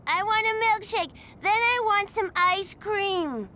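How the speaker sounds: put-on high, childish demanding shout; a whiny kid yelling for a treat; shouting little boy. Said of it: "he shouted".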